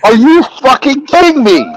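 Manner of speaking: high-pitched outraged disbelief; retold angry yelling. Snake handler retells yelling at Steven for running, angry and high. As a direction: yell it in mock outrage, voice jumping up. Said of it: "I'm yelling at Steven".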